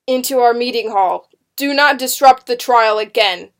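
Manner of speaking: loud, forceful warning; commanding rebuke. A narrator's reading. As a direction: scold her before the court. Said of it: "Matthew Fire commanded".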